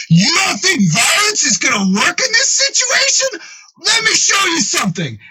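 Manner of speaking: high-pitched, hammed-up furious rant; enraged retort. Enraged Frank throws the words back.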